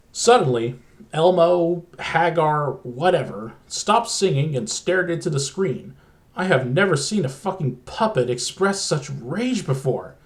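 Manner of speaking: plain reading voice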